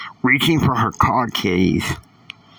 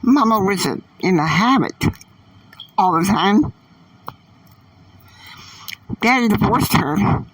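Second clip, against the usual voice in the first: put-on much higher teen girl voice, confiding; 10 semitones higher than usual. A narrator's reading. Annie telling Catalina about her mother, high girlish voice. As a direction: pitch up and tell it like a young girl. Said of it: "Annie said".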